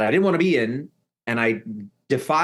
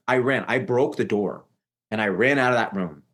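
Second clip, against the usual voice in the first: usual speaking voice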